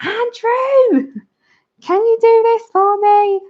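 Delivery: put-on high, loud call to her husband, posh accent dropped; calling out to Andrew after hanging up; back to normal voice. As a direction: shout across the house in her everyday voice. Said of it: "you drop the accent".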